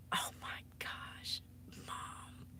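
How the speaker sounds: slow breathless whisper; mortified whisper. Real, not acted. Streamer to her audience, whispering in embarrassment. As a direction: hide in the words, barely voiced.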